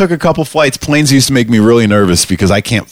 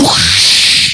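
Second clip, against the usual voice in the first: whoosh sound. Retold or imitated. Telling how the co-pilot got sucked out, shouted whoosh.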